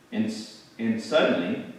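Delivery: ordinary delivery, nothing marked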